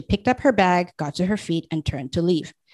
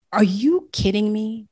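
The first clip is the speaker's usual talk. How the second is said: slow, higher, menacing growl; 5 semitones higher than usual. A narrator's reading. Paxson confronts the bullying husband, slow menacing growl. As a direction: let the threat sit under the question. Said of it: "His voice was a growl".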